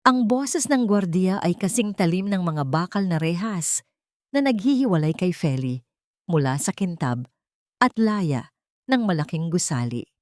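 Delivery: ordinary delivery, nothing marked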